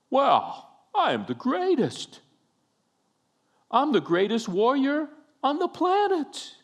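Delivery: hammed-up, high-pitched braggart; mock boasting. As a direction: crow like a pompous, demanding champion. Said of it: "He didn't say".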